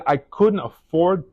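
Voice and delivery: slow down as emotion catches in the throat; choked up. Real, not acted. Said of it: "I'm gonna get choked up here".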